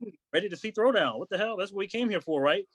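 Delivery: high, indignant objection, not shouted; retold protest. Him to friend who wants to leave, indignant. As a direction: protest in mild disbelief. Protests leaving before headline band.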